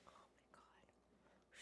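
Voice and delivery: hushed breathy oh my God; whispered aside. Real, not acted. Owner whispering while her dog twirls. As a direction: breathe it under your breath.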